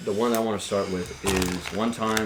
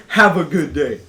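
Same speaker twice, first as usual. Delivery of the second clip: pointed, louder and higher farewell; weird tone; 5 semitones higher, 8 dB louder than usual. Retold or imitated. Barista recalling the parting words to customer, weird tone. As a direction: say the farewell loudly with an odd edge. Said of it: "in a weird tone".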